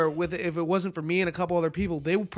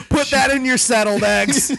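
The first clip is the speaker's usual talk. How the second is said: laughing; 5 semitones higher, 7 dB louder than usual. Real, not acted. Joke through laughter.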